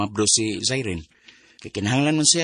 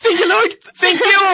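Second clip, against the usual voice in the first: much higher, much louder cries of joyful thanks; overjoyed shout; 10 dB louder than usual. Acted. Husband thanking God after the good news, overjoyed shout. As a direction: shout your thanks to the heavens.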